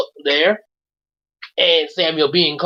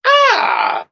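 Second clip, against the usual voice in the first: sudden cry; 5 dB louder than usual. Acted. Hungry boy cries out.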